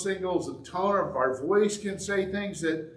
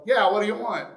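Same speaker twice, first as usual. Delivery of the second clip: louder, blunt challenge; gutsy retort; 4 semitones higher, 6 dB louder, faster than usual. Retold or imitated. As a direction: call him out boldly. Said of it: "she was gutsy enough to go".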